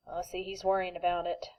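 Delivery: usual speaking voice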